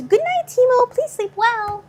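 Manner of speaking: high, loud sing-song goodnight; high sweet goodnight. Acted. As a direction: coo it up high like to a pet.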